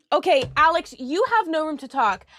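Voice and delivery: ordinary talk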